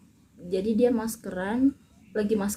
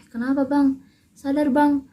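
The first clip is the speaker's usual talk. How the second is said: higher, louder pleading call; urgent calling; 5 semitones higher, 9 dB louder than usual. Retold or imitated. Uncle shaking the father, calling him loudly to wake.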